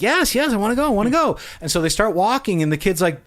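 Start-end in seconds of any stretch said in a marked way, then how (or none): 0.0-1.4 put-on eager child. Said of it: "He's like".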